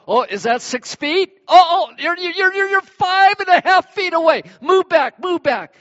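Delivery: high, loud, alarmed yelp of someone too close; mock panic. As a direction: play the shopper backing away in a fright. Acting out social-distance panic.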